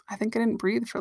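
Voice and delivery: ordinary talk, nothing marked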